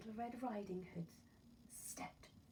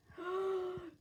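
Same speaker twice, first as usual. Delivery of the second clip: startled gasp; 11 semitones higher, 7 dB louder than usual. Acted. Girl's fear before the eyes line.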